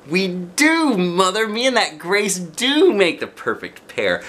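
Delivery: high, loud eager agreement; thrilled little girl; excited child. A narrator's reading. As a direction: burst out happily.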